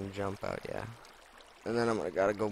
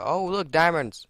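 excited; 7 semitones higher, 8 dB louder than usual. Real, not acted. Finds diamonds.

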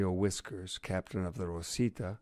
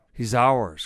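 loud, raised cry; triumphant cry; 4 semitones higher, 10 dB louder than usual. A narrator's reading. Fox closing in on the thief by plane, crying out. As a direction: cry out, sure of the catch. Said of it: "cried Fox".

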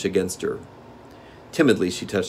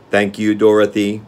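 monotone mechanical voice, louder; evenly spaced clockwork speech; Tik-Tok the machine man; 7 dB louder than usual. A narrator's reading. Machine man thanks the girl who wound him, mechanical monotone.

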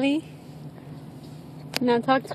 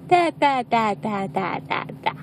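imitating baby babble; 3 semitones lower than usual. Parent echoing what baby said, babbling. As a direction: run of babbled syllables, sliding down and softening. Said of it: "Is that what you said?".

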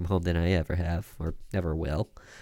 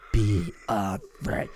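slow, menacing phone voice; creepy slow voice; slower than usual. Acted. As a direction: drag each word out, sinister. Creepy caller on the line.

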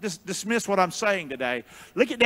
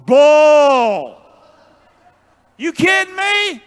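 loud, high "Bull" thrown out in disbelief; scoffing shout; 7 semitones higher, 10 dB louder, much slower than usual. Real, not acted. Preacher shouting down the idea that coupons are for poor people.

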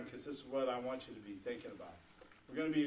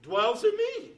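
loud, emphatic sermon climax; high, loud proclamation to the congregation; full-force preaching; 5 semitones higher, 8 dB louder than usual. Real, not acted. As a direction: preach it out with rising conviction.

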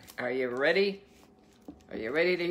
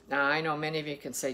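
ordinary delivery

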